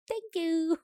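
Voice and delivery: put-on high, soft little-kid voice; toddler imitation. Co-host echoing friend's toddler, high soft kid voice. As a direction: softly copy a tiny child's thanks. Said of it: "Finn this week has been saying thank you".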